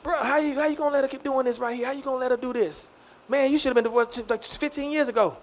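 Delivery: imitating relatives urging on the quiet; ex's family. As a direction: confide it in disbelief, well under full voice. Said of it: "I had even my ex's family saying".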